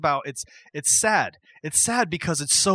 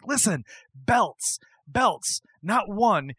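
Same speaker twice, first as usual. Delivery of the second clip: emphatic interruption; 5 semitones higher, much slower than usual. Retold or imitated. Acts Bryan interrupting with correction.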